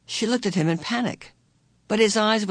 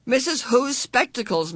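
a sudden higher burst of realisation; suddenly remembering, a touch higher; sudden idea; 7 semitones higher than usual. A narrator's reading. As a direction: blurt it out as the idea hits. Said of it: "Meg said suddenly".

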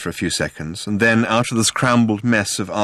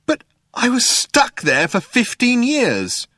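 slow, high-pitched wail of grievance; aggrieved protest; 14 semitones higher, slower than usual. A narrator's reading. Ford learns Zaphod visited Earth, protesting.

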